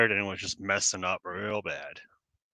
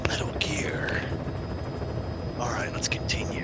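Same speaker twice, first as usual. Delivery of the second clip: whispery gruff soldier voice; Snake voice; 3 dB quieter than usual. Acted. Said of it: "play the entire game with my Snake voice".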